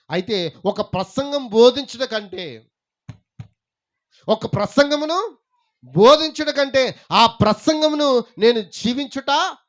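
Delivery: loud, high-pitched sermon delivery; full-force preaching. Acted. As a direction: proclaim it to the whole congregation. (గొంతెత్తి ఉద్వేగంగా ప్రసంగిస్తూ)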